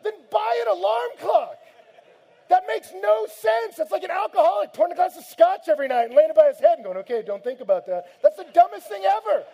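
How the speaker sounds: exasperated shout. Real, not acted. Preacher mocking the alarm clock excuse, furious shout. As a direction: blast the excuse as absurd.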